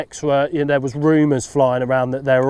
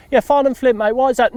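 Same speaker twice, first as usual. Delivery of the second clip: high, louder lads' answer; imitating the lads; 10 semitones higher, 3 dB louder, faster than usual. Lad telling the lost angler the lake, high and louder. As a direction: answer in a much higher put-on voice.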